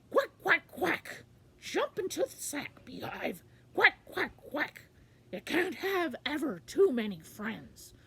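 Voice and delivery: high, loud quacking duck. Acted. Quackling inviting the beehive into the sack, high loud duck voice. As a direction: quack out a hearty welcome. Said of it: "So he said".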